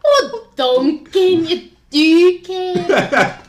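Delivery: slow, drawn-out, high and loud insult; Rupert's line. Acted. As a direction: stretch out the insult lazily. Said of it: "And then Rupert says".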